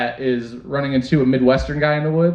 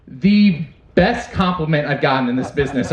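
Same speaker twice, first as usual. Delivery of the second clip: ordinary talk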